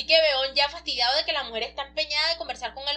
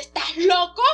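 put-on louder, higher indignant child; worked up; 5 semitones higher, 7 dB louder, slower than usual. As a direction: blurt it out all worked up. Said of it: "Toda exaltada".

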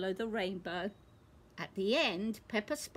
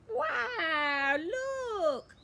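much higher, louder joyful cry; excited shout; 10 semitones higher, 4 dB louder than usual. Acted. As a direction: burst out in delight. Unicorn found, big cheer.

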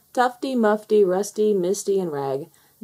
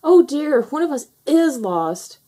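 high, loud dismay; alarmed cry; 8 semitones higher, 6 dB louder than usual. A narrator's reading. Tufty to the little foxes after miscounting, alarmed cry. Gasped alarm at missing fox.

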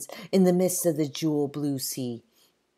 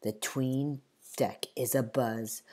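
ordinary delivery

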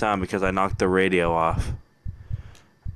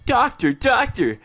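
high, slow, alarmed nurse voice; panicked nurse; 12 semitones higher, slower than usual. Pretend. Gamer voicing a nurse warning the doctor, high and slow. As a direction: squeal it like a frantic nurse.

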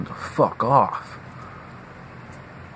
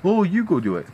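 higher mocking dismissive parent; 7 semitones higher than usual. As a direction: brush it off like a lazy parent. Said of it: "And I get the".

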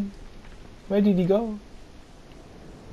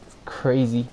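ordinary delivery

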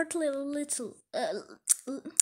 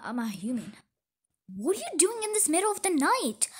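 ordinary talk

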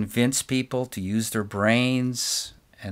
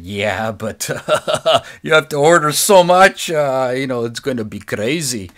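put-on failed German accent; 3 semitones higher, 10 dB louder than usual. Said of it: "I can't do a German accent".

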